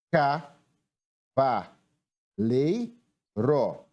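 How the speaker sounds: "Ca, va, lei, ro" one syllable at a time; separated syllables, evenly weighted. Shown as a demonstration. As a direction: spell out the syllables with pauses between. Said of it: "nós temos quatro sílabas".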